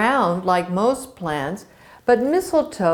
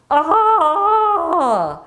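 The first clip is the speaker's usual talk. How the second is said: short high scream from a nightmare; high frightened yelp; 9 semitones higher, 5 dB louder than usual. Acted. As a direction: wake up screaming. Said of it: "he screamed".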